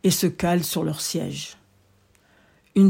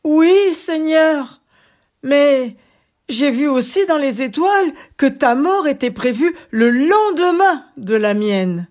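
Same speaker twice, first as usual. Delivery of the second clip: nervous; 7 semitones higher, 5 dB louder than usual. Acted. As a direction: answer with a nervous edge. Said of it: "qui n'en menait pas large".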